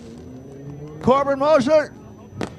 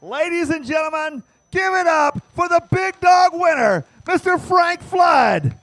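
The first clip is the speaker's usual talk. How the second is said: booming pumped announcer; 5 semitones higher, 4 dB louder than usual. Acted. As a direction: shout the winner's name to the stands. Announcer calling out the winner.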